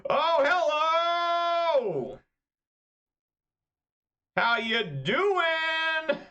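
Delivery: very high, slow, drawn-out shout; mock greeting. Real, not acted. Streamer greeting the opponent's blowout with a drawn-out high shout. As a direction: stretch out a sarcastic hello. Greeting the big play mockingly.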